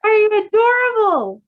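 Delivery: high, loud delighted squeal. Real, not acted. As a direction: gush at how cute he is. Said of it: "I just screamed into the bear pillow".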